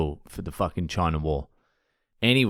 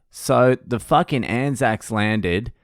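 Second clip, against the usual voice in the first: normal talking voice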